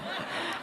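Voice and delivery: soft unvoiced chuckle; a quiet laugh under the breath; breathy silent laugh. Real, not acted. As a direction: silent giggle. Laughing at own story.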